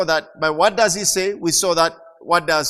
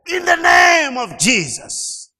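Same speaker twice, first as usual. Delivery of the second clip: shouted high, slow rebuke; loud command; 10 semitones higher, 7 dB louder, slower than usual. Retold or imitated. Preacher reenacting commanding the trailer, slow shout. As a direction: command the runaway trailer to stop. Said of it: "I said it loudly".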